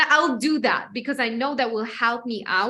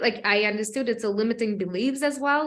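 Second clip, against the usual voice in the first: normal talking voice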